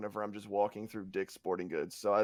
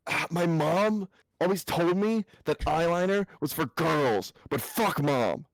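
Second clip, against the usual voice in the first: defiant emo; 7 semitones higher, 7 dB louder, slower than usual. Pretend. Mock emo rebel.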